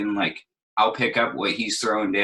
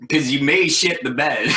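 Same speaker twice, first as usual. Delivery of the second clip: laughing; 6 semitones higher, 5 dB louder than usual. Real, not acted. Laughs about failing a set.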